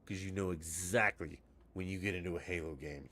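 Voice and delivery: ordinary talk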